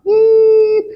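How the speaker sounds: high, loud held whine; imitated siren. Storyteller as the rescue team passes by, imitated siren.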